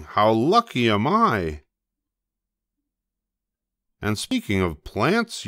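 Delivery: ordinary delivery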